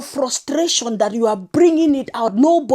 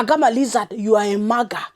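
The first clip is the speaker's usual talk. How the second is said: ordinary delivery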